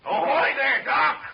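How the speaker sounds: loud, high urgent yelling; shouting for help. Acted. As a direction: holler across the boat for help. Shouting for help, someone fainted.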